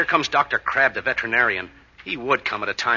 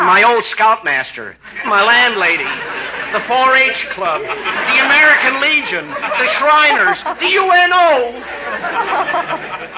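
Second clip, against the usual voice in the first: climbs much higher and louder, slowing; building wail; 11 semitones higher, 6 dB louder, slower than usual. Acted. Mel listing everyone against the marriage, rising to a wail.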